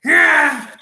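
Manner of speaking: high loud yell; excited scream; hype shout. Acted. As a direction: bellow the yeah. Hype shout after impression.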